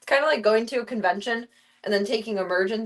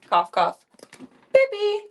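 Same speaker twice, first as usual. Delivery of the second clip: spoken cough cough then a high beep beep; mock censor noises; 11 semitones higher, 5 dB louder than usual. Bleeping herself out to viewers, mock censor noises. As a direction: fake a cough and a bleep to cover the word.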